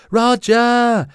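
high, loud, drawn-out shout; calling out. A narrator's reading. Lyra searches for Roger in the crowd, loud high call. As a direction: shout the name across a crowd, pitched high. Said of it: "rief Lyra".

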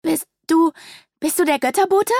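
put-on a scared little girl calling out; Ira, a frightened child, asks if this is the divine messenger. A narrator's reading. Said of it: "Iras erschreckter Ausruf".

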